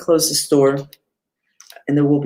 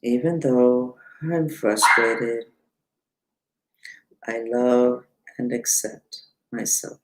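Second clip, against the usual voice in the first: slow, soft mantra chant; mantra recitation; 3 semitones lower, 4 dB quieter, slower than usual. Shown as a demonstration. Teacher leading viewers through the mantra, slow soft chant. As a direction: intone the mantra gently, softer toward the end. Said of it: "I'm gonna say it three times out loud".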